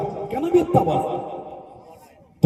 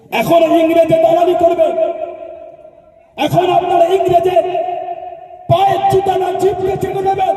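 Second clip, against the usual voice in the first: angry shout; 7 semitones higher than usual. Real, not acted. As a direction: rail at them with fury. Angry tirade against collaborators.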